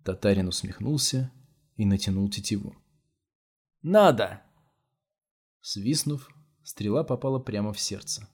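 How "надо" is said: насмешливо. A narrator's reading. Татарин насмехается, натягивая тетиву.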